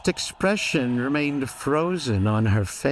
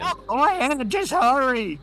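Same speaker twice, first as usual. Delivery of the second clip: put-on higher, urgent girlish plea; 12 semitones higher than usual. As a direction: pitch it up like a pushy girl. Carly Beth voiced high.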